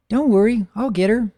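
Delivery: high, loud filly voice; eager filly. Acted. Filly promising to fetch her friend, high and loud. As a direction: call it out brightly like a keen little girl. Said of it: "Cherry Berry Pie says".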